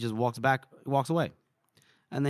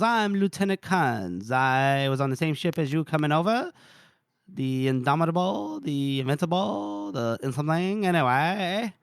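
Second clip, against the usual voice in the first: Lieutenant Kans; 3 semitones higher than usual. Acted. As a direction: draw out the words in a put-on officer's voice. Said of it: "somebody comes up to Colonel Gorst".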